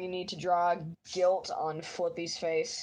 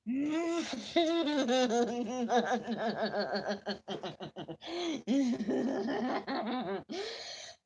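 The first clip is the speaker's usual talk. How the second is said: long laughing fit; 4 semitones higher than usual. Real, not acted.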